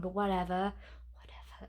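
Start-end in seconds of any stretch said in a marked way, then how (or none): 1.1-1.7 unvoiced whisper. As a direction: breathe it as a whispered aside. Whispered repeat of whatever.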